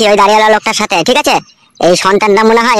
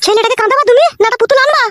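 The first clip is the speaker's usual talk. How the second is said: much higher, shrill-pitched wife voice; scolding mother; 13 semitones higher than usual. Acted. Wife scolding husband over crying son, high-pitched.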